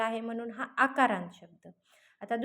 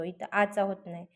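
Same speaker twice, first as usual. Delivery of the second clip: ordinary talk, nothing marked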